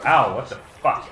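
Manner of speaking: usual speaking voice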